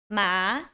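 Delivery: MA rising in pitch; second tone. Shown as a demonstration. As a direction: let "ma" climb upward. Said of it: "Second tone goes up".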